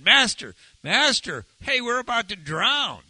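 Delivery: high, loud cry for help; alarmed calling out. Acted. Disciples waking Jesus as the boat sinks, alarmed calling out. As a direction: wake him with an urgent shout.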